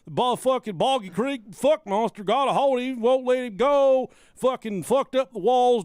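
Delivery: ranting trailer-park homeowner; man down shout. Acted. Hillbilly trailer owner complaining.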